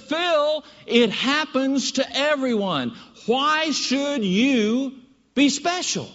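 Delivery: high, very slow put-on wife voice; mock wife voice. Wife brushing off husband's complaint, high slow mock voice. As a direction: drag it out as the unimpressed wife.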